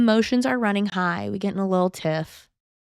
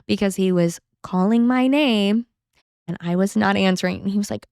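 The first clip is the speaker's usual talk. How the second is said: normal talking voice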